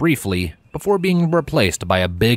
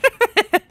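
put-on high, bright girlish giggle; 4 dB louder than usual. Mare giggling at a stiff formal bow, a high giggle. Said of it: "elicited a giggle from her".

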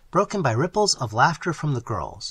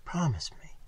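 pleading whisper; 3 semitones higher, 7 dB quieter than usual. Acted. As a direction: beg him under your breath. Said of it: "whispered Sylvia".

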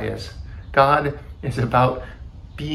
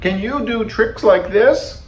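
high, boastful question; crying out; 11 semitones higher, 4 dB louder than usual. A narrator's reading. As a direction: show off to your friend in the audience. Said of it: "cried Toad".

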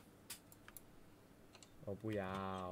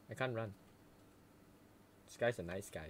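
ordinary delivery

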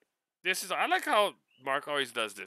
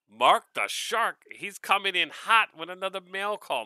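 big showman intro; hype announcement; 7 semitones higher, 5 dB louder than usual. Real, not acted. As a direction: announce him like a ring entrance. Hyping up his friend's name.

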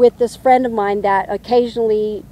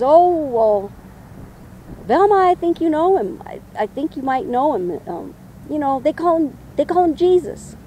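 higher-pitched, loosely attempted Irish accent; amateur Irish accent, pitched up; 5 semitones higher than usual. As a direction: imitate a friend's fake Irish accent. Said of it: "I can't do that accent".

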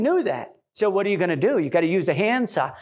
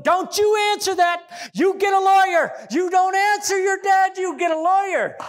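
yelling; 9 semitones higher than usual. Retold or imitated. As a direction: shout the warning across the house. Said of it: "he starts yelling from the other room".

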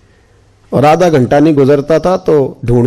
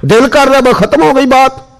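higher, impassioned sermon voice; full-force preaching; 8 semitones higher than usual. Acted. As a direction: preach it to the crowd, fervent. (जोशीला, ऊँची आवाज़ में was)

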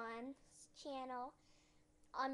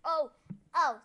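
ordinary delivery

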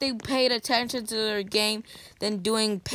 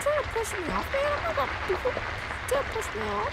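much higher whiny voice; mock squeaky voice; 13 semitones higher, faster than usual. Real, not acted. Gamer whining at a player pushing him off, squeaky voice. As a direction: whine it like a cartoon. Mocking high voice.

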